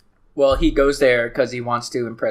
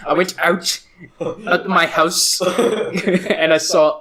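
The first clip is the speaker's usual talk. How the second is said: higher, louder, very slow comic storyteller; Dane Cook impression; 6 semitones higher, 3 dB louder, much slower than usual. Doing a Dane Cook bit, slow hammed-up comic voice. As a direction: stretch it out like a stand-up bit. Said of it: "that's my Dane Cook".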